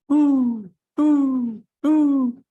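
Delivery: high, loud hooting owl. Acted. Spooky forest at night, an owl hooting. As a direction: hoot it like an owl in the dark.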